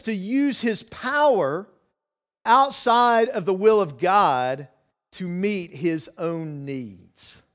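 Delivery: each phrase slowed, with pauses between; slow, emphatic reading. Shown as a demonstration. Preacher lays out the first temptation to the church, slow and deliberate. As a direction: spell it out slowly for the congregation. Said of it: "To use his power outside the will of God to meet his own needs".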